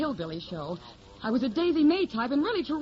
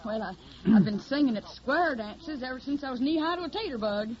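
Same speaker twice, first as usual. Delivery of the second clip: put-on hillbilly accent. Hildy poses as a Tennessee country girl, in a put-on Southern accent. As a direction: play the naive Southern hillbilly singer. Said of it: "I got the technique down pat".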